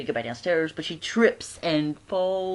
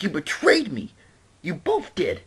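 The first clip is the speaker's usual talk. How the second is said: betrayed outburst; 4 semitones higher, 4 dB louder, slower than usual. Retold or imitated. Friend betrayed, storming off.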